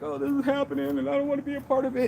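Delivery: high, rushed whine; mock whining. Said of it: "I was just kinda whining".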